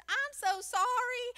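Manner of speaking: high, slow, drawn-out Southern apology; Southern accent. Retold or imitated. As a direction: lay on the Southern accent to plead sweetly. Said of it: "tried to turn on my Southern accent".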